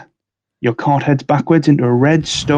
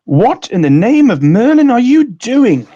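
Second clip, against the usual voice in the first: high, astonished outcry; indignant wizard; 10 semitones higher than usual. Acted. Bill confronts the intruders at Gringotts, indignant high outcry. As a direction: exclaim it in high-pitched alarm.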